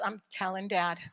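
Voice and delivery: low, soft deadpan tattle; imitated son. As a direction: say it under your voice, as a retold threat. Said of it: "looks at me and goes".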